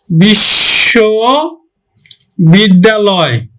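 বিশ্ববিদ্যালয় very slowly, in separated parts; dictation-slow বিশ্ববিদ্যালয়; sounded out slowly. Shown as a demonstration. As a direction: stretch বিশ্ববিদ্যালয়, pausing between বিশ্ব and বিদ্যালয়. Teacher slowly sounds out the word.

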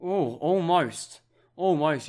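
agonised over a near goal; so close, loud and slowed down; groaning near miss. Real, not acted. Near miss in live commentary.

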